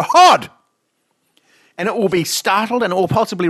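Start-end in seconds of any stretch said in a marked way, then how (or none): 0.0-0.5 loud, high barked HOD to startle; a sudden yell at the petted dog; sudden shout. Retold or imitated. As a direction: shout it out of nowhere. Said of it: "And then you go".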